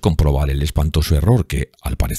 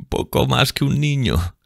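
high, slow mocking echo; mocking repetition; 10 semitones higher than usual. A narrator's reading. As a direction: sneer his words back. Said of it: "repitió con voz burlona".

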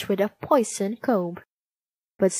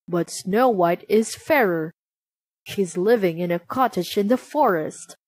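ordinary delivery

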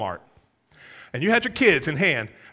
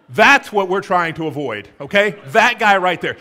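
loud, emphatic fatherly warning; acted dad laying down the law; mock parental warning; 10 dB louder than usual. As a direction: point out the bad example, loudly and firmly. Voiced parent warning daughter about men.

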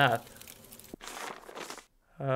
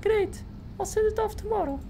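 much higher falsetto barmaid. Acted. Player voicing the barmaid thanking him, in a much higher falsetto. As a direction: squeak it up high like a put-on barmaid. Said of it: "accents are very inconsistent".

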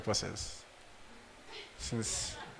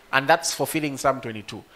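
ordinary talk

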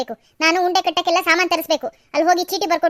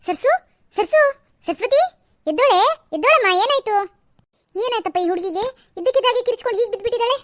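higher, anxious calls to wake her; worried calling; 5 semitones higher than usual. Acted. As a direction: shake her awake in worry. Trying to wake the fainted girl. (ಆತಂಕದಿಂದ ಎಬ್ಬಿಸುವ ಕರೆ)

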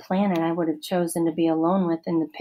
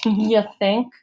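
laughing; 3 semitones higher, 4 dB louder than usual. Acted. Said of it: "I laughed".

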